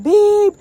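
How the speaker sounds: short high beep, loud like a horn; mock car horn. Other driver honking Stan off, a loud high beep. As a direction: honk it high and sharp. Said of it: "the other person's like".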